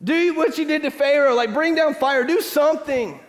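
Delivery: high loud demanding cry; demanding Israelites. Acted. Israelites demanding God repeat Jericho, high insistent cry. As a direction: insist God bring down fire. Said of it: "they're like".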